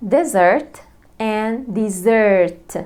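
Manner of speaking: "desert" stressed first, then "desert" stressed second, slow; contrasting stress. Shown as a demonstration. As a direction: say "desert and desert" slowly and shift the stress. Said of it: "تاني كلمتين". (كلمة desert بالنبر على المقطع الأول ثم التاني ببطء)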